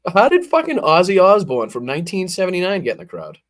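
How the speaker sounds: high, loud disbelief breaking into laughter; can't believe who's in the crowd; incredulous laughing outburst. Real, not acted. Streamer spots a lookalike in the rally crowd, incredulous.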